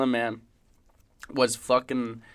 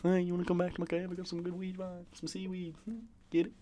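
soft, high stoner shark; shark character; 5 semitones higher, 12 dB quieter, faster than usual. Acted. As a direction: play the shark quietly offering weed. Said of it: "The shark".

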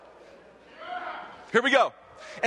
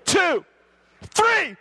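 building count; 11 semitones higher, 7 dB louder, slower than usual. Real, not acted. Preacher counts the crowd in, ending in a shout. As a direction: count rising to a high loud shout. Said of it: "I almost don't wanna say three".

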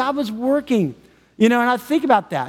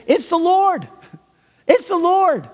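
excited cry; 9 semitones higher, slower than usual. Acted. Quoting the disciples' cry.

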